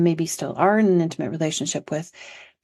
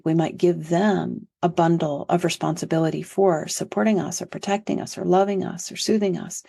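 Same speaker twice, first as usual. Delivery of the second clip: ordinary talk, nothing marked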